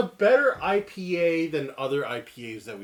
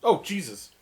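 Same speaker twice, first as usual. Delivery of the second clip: usual speaking voice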